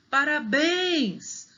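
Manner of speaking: PARABÉNS with a long, high final BÉNS; calling the word. Shown as a demonstration. Teacher demonstrating final stress, calling paraBÉÉNS. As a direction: stretch and lift BÉNS at the end of parabéns. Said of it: "o bens é a sílaba mais forte".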